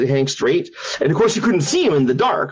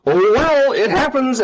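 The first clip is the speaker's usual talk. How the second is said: high, breezy brush-off; mock nonchalance; 10 semitones higher, slower than usual. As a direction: shrug it off in a raised singsong. Guides shrug off missing visitor.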